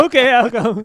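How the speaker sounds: loud, high mock giving in; reluctant mock agreement. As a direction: cave in loudly, half laughing.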